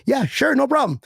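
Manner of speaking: chirpy high yes; high eager agreement. Retold or imitated. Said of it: "they're like".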